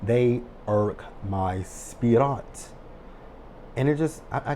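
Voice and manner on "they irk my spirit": drawn-out, deliberately slow; very slow, fed-up declaration; slow and weary. Real, not acted. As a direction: drag each word out in disgust. Disgust at Calvin and Sabrina.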